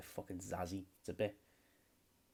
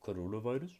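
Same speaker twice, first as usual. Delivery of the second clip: put-on joking African accent, louder, rising into a question; comic African-accented question; 2 semitones higher, 5 dB louder than usual. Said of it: "that was my African accent".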